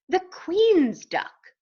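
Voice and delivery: high, slow British grandeur; British accent. A narrator's reading. Duck announces his royal rank, slow British accent. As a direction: stretch it out in royal British style. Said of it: "He spoke with a British accent".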